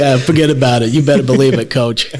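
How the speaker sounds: brash Brooklyn guy, loud and cocky; Brooklyn accent. Pretend. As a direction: throw on a Brooklyn accent for the host. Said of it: "in a Brooklyn accent, please?".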